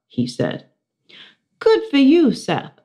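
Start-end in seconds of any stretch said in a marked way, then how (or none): 1.6-2.8 high squeaky little hamster voice; bright high hamster squeak. A narrator's reading. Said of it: "I squeaked".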